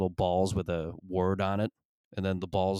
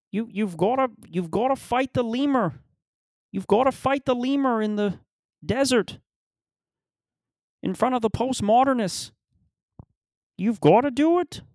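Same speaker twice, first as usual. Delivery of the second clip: high-pitched, urgent caricature of a guru; Jordan Peterson; 14 semitones higher, 4 dB louder than usual. Acted. Comic spoofing the guru's random-word allegories, high mocking voice. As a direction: squeeze out absurd advice far above your normal pitch. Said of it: "That's what I feel like Peterson has".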